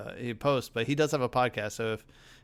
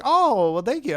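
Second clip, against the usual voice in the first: high, louder imitation of a modest old man thanking; touched old man; 10 semitones higher, 6 dB louder than usual. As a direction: accept the praise humbly as an old man. Bob Gurr voiced in story.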